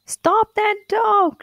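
high, slow, loud shouted order; king shouting. A narrator's reading. King shouting at the crowd to stop the dog, high and loud. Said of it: "shouted the king".

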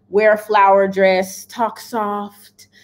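ordinary talk

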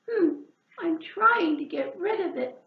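high, huffy reply; sniffed. Acted. Hedgehog huffing at frog, high sniffy reply. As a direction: answer with a sniffy huff. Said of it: "sniffed Hedgehog".

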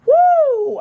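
very high, loud whoop; excited whoop. Real, not acted. Birthday vlogger whoops with joy. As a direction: cheer for your birthday.

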